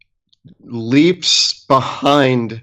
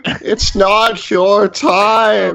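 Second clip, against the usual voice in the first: slower, fading solemn repeat; 7 semitones higher, much slower than usual. Acted. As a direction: let the words hang gently. Gnome comforts his frightened friend.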